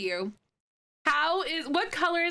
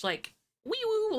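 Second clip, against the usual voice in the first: mock siren noise; 10 semitones higher than usual. Siren noise for emergency patch.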